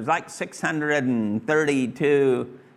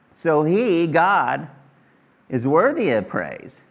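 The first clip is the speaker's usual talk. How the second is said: usual speaking voice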